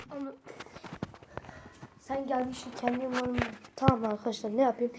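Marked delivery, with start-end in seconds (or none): none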